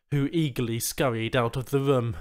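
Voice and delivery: ordinary delivery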